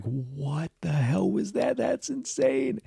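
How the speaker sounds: high, slow, soft incredulous whine; stunned disbelief. Real, not acted. Vlogger reacting to the ending, stunned and incredulous. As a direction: drag it out in disbelief.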